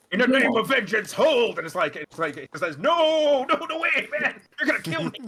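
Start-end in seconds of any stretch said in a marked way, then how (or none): 0.1-1.6 loud, high spell command; commanding battle cry. Acted. Paladin casting Hold Person. 2.8-5.2 high, laughing panicked pleas; panicked pleading. Acted. Fleeing man panics, GM voices.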